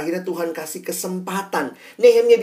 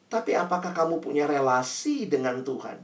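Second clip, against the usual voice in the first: usual speaking voice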